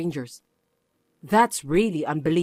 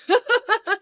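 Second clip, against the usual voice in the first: nervous laugh. Acted. Nervous laugh mid excuse.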